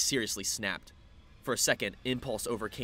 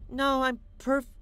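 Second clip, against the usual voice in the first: put-on tipsy girl voice; 6 dB louder, slower than usual. Drunk heroine protests.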